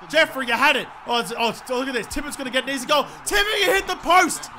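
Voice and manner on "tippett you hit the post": high-pitched exasperated yell; real frustrated shout; outraged disbelief. Real, not acted. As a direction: cry out at an unbelievable miss. Tippett misses easy goal.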